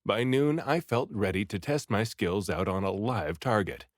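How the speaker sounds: ordinary delivery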